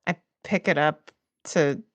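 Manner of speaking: normal talking voice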